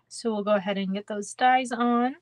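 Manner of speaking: usual speaking voice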